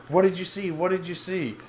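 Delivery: frantic high-voiced questioning, retold; panicking. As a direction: act out a panicked mother demanding answers. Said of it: "she's totally panicking".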